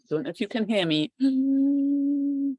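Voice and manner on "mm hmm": throat breath. Shown as a demonstration. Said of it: "let's try some Ujjayi breath".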